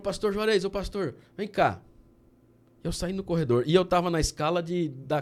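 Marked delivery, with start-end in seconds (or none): 0.0-1.8 calling out. Retold or imitated. Boy calling him in corridor.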